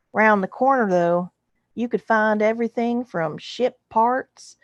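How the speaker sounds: hammed-up bad Texas drawl for a Western-style narrator; fake Texas drawl. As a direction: tell it like a TV cowboy with a Texas twang. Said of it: "I'm going to read it in that drawl".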